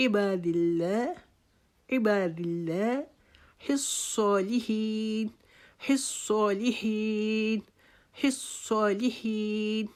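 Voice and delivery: عباد اللہ الصالحین slowly with long vowels; slow recitation. Shown as a demonstration. Teacher reciting الصالحین slowly for students. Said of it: "ایک الف کی مقدار لمبا".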